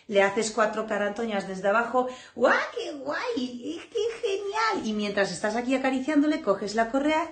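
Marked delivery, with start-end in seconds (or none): 2.3-4.9 slow, much higher gushing praise with a laugh; delighted praise. Retold or imitated. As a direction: gush over the pup like it's the best thing ever. Praising the puppy that arrives.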